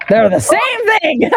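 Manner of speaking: high, drawn-out exclamation; stretched, high-pitched amazement; amused disbelief. Real, not acted. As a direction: exclaim it high, on the edge of laughter. Amused reaction to matching dice roll.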